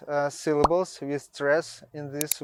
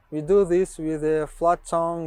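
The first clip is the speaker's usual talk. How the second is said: ordinary talk, nothing marked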